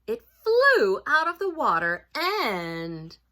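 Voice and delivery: loud, dramatic storybook reading; story narration. A narrator's reading. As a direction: build suspense for children, punching the action.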